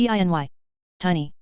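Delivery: ordinary talk, nothing marked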